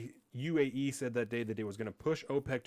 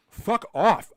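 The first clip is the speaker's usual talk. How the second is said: angry; 8 semitones higher, 10 dB louder than usual. Real, not acted. Angry outburst.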